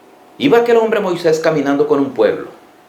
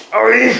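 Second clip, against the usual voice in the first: sudden high loud cry of pain; sharp ouch from a stubbed toe; pained yelp; 11 semitones higher, 5 dB louder than usual. Pretend. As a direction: yelp like you just stubbed your toe. Stubbing toe on bed leg.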